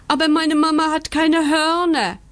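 high, drawn puppy wail; yowling. A narrator's reading. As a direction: howl out the protest. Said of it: "jault Bandit".

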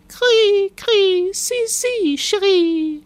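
very high, loud bird coo; cooing giggle. A narrator's reading. Laughing dove singing French to the starling, cooing high. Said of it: "hatte sie girrend gekichert".

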